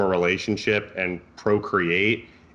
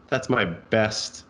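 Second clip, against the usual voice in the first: normal talking voice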